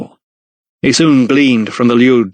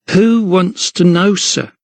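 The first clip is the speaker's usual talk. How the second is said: put-on guarded young woman, higher and slow; wary girl; 8 semitones higher, slower than usual. A narrator's reading. Agnes answers the stranger, a touch wary. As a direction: hold back, suspicious of him. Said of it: "she replied warily".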